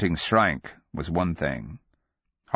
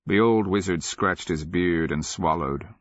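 ordinary delivery, nothing marked

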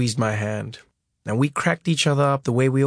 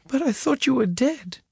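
much higher, cracking with tears; tearful; 12 semitones higher than usual. A narrator's reading. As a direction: sob it out. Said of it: "I kept bawling and bawling".

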